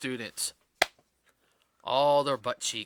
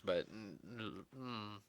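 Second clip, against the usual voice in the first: ordinary talk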